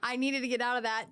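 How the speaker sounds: ordinary talk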